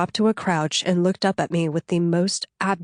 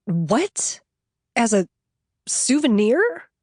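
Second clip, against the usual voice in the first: disgusted; 5 semitones higher, slower than usual. A narrator's reading. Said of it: "I didn't mean to sound so disgusted".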